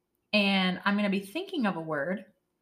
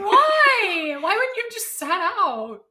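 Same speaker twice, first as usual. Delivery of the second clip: high, loud wail of disbelief; aghast disbelief; 10 semitones higher, 8 dB louder, slower than usual. Real, not acted. Cohost reacts to the tiny-cleats story, aghast. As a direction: cry out "why" as if pained by the story.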